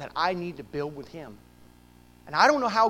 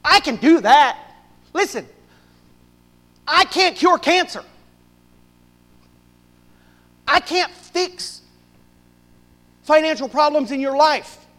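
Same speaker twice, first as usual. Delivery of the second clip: louder, higher pulpit preaching; 9 semitones higher, 11 dB louder than usual. Acted. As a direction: drive it home to the congregation. Preaching at full force.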